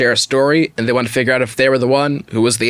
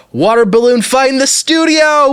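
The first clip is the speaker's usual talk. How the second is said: loud, high hype call to open the show; shouted water balloon hype; excited announcement; 11 semitones higher, 4 dB louder than usual. Acted. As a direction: sell it like a big stunt reveal. Hyped announcement of a gag segment.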